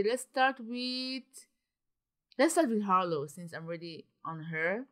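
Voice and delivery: ordinary delivery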